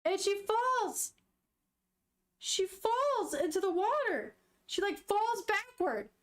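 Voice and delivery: real shock, voice jumping up; shocked alarm. Real, not acted. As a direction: react aghast as the plot turns. Shocked reader reacts to scene.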